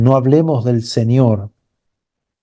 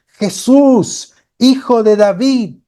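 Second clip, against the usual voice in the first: blind man crying out; 10 semitones higher, slower than usual. A narrator's reading. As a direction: call out desperately over the crowd. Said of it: "él seguía gritando".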